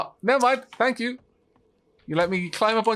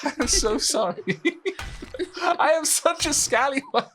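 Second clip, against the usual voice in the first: very high words dissolving into laughter; laughing apology; 13 semitones higher than usual. Real, not acted. Builder owns up to mischief, laughing helplessly. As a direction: squeeze it out through giggles.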